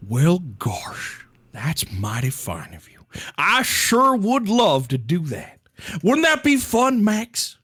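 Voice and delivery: lightly put-on Southern drawl; a hint of folksy Southern charm; Southern accent. The monster father thanks the ship, in a light Southern accent. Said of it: "you held the accent better than I could".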